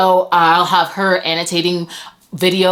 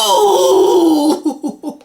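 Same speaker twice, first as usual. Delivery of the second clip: surprised cry; 9 semitones higher, 4 dB louder than usual. Real, not acted. Surprised by gift.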